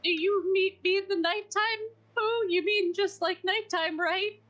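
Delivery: high, worried little character voice; anxious Piglet. Acted. Piglet anxiously questions Pooh's darkness remark.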